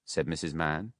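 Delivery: plain reading voice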